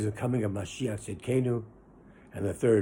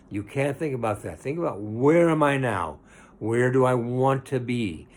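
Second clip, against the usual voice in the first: ordinary talk, nothing marked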